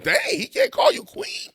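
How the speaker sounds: mock the overreaction in a high squeal; incredulous mock. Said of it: "I'm like".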